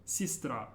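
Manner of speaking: "sistra" stressed on the final "tra", pitch falling; stress on last syllable. Shown as a demonstration. Teacher repeating the word for learners, final syllable stressed. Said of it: "emphasis is on the very last syllable".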